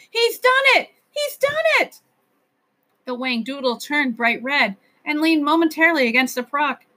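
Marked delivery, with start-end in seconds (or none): 0.1-2.0 put-on high, thrilled child's cry; triumphant cry. A narrator's reading. Ben cheering the professor's success, high and triumphant. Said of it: "Ben cried in triumph".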